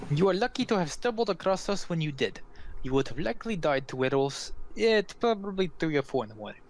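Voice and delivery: put-on accented scolding that starts high and settles; admonishing Vistani woman. Vistani woman tells travelers of werewolf danger, accented and admonishing. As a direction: foreign woman telling them how lucky they are.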